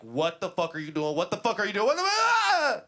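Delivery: outrage building to a high yell; tripping out. Retold or imitated. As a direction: escalate from heated disbelief into a scream. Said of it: "I get to tripping instantly".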